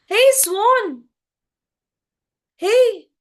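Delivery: high, loud hail; excited calling out. A narrator's reading. Crow hails the swan across the lake, calling out excitedly. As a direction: call out eagerly, high and loud. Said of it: "he's so excited, he calls out".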